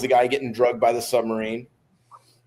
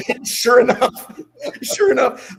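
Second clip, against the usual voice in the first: high, loud, laughing through the words; trying to carry on through laughter; 12 semitones higher, 6 dB louder, slower than usual. Real, not acted. Teller cracking up as he resumes his running story, laughing.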